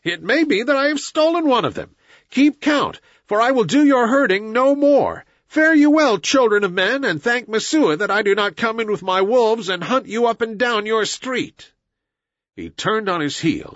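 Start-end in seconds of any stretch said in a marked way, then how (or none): 0.0-11.7 high, sustained taunting tirade; scornful shout. A narrator's reading. Mowgli bids the village a scornful farewell, shouting. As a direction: keep the pitch high and pour out scorn. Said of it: "shouted Mowgli scornfully".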